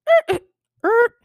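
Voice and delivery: high, loud caught-out stammer; stammering yelp. Retold or imitated. Caught with his daughter, he stammers high and loud. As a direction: stutter like a man caught in a lie.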